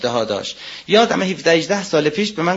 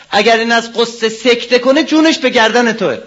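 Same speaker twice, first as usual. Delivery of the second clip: higher and louder, pressing on جونش; louder, emphatic warning; 6 semitones higher, 6 dB louder than usual. Retold or imitated. Preacher repeats the elder's warning to the congregation, forceful and loud. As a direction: drive the warning home hard.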